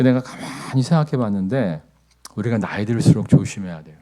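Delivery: ordinary delivery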